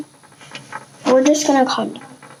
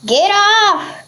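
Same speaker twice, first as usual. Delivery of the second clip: yelling; 7 semitones higher than usual. Real, not acted. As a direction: yell it to shoo the bug. Shooing the fly.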